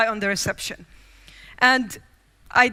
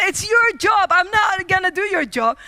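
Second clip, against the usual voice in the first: defiant retort; 6 semitones higher, 4 dB louder than usual. Retold or imitated. Her defiant reply to him.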